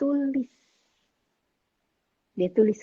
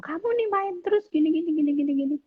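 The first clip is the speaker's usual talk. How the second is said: higher, mock-nagging mother; nagging mom; 7 semitones higher, faster than usual. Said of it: "mama misalnya bilang". (ibu yang mengomel, nada lebih tinggi)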